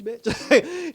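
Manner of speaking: sudden high, loud mock snap on the last word; retold villain retort. Retelling Mila's comeback to Laura's hero speech, high loud mock retort. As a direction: fling the insult back like Mila taunting the hero.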